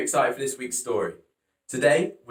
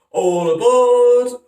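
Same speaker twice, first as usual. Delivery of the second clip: long high hollered call, much louder; 11 semitones higher, 11 dB louder, much slower than usual. Acted. Noah calling the animals aboard, long high holler. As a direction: holler it across the whole ark. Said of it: "hollered Noah".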